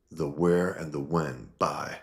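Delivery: usual speaking voice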